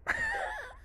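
high shrieking laugh; burst of laughter. Real, not acted. Laughing at her own sex story.

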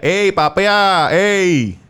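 slow, high calling-out yell; mock cop shout across the street; guard yelling. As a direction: call him back like a street cop. Guards calling out to drunk friend.

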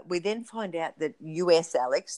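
ordinary delivery

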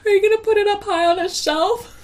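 put-on high little-boy question; imitating her son. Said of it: "he always like".

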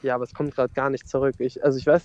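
ordinary talk

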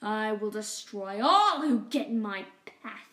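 villain rat vowing to rule; ranting Rat King. Acted. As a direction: declare your conquest with menace. Rat King's villain speech.

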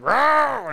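loud, high mock growl; playful monster "rawr", drawn out; vampire attack roar. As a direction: imitate a vampire lunging in for the attack. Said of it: "she vamps out and attacks him".

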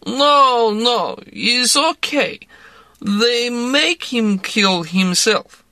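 tipsy Russian woman urging a drink; Russian accent. A narrator's reading. Woman pressing vodka on narrator, in a Russian accent. Larissa voice.

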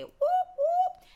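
high, squealing celebratory whoop; party cheer for hump day; excited whoop. Real, not acted.

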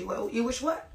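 usual speaking voice

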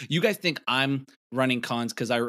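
ordinary delivery

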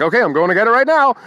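overly chipper, teasing the guard; mock-eager reply. Mocking the mall security guard with fast, fake cheer. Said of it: "totally making fun of him".

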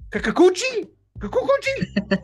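high, loud, exaggerated Kakakuchi; mock pronunciation attempt. Shown as a demonstration. Host trying a tricky pitcher's name for the audience. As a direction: sound out Kakakuchi in a big, unsure high voice. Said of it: "make sure I get this right".

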